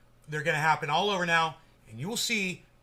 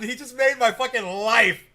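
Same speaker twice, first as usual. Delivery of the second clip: ordinary delivery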